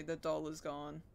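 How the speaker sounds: usual speaking voice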